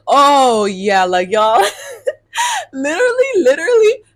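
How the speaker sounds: high, loud laughing cry of "literally"; laughing in disbelief. Real, not acted. Telling viewers about the date, a high laughing outburst. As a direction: crack up at how absurd it is.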